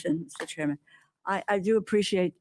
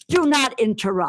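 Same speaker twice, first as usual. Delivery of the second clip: sharp, loud and higher; angry; 5 semitones higher, 6 dB louder than usual. Real, not acted. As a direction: firmly shut down the interruption. Congresswoman cut off in heated exchange.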